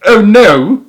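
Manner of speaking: high, loud horrified cry; a boy crying out in disgust; loud high horrified child's cry; cried out. A narrator's reading. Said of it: "cried William".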